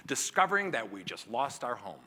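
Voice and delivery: ordinary talk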